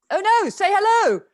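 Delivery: loud, high, eager call to the room; excited calling out. Real, not acted. Host urging muted guests to unmute, excited loud call.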